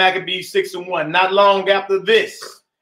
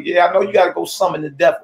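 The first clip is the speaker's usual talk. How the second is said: fast sarcastic retort; much faster than usual. Retold or imitated. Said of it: "I'm like".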